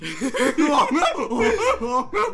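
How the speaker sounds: loud, high burst of laughter. Real, not acted. Players laughing as the illusion shatters, loud.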